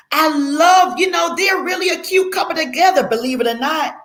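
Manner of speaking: put-on high-pitched, gushing girl talk; acted happy. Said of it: "They see you as being happy".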